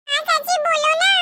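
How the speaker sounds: put-on high, eager little girl's voice; child voice. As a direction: play a small child pleading sweetly. Child pleads with aunt. (ऊँची आवाज़ में छोटी बच्ची)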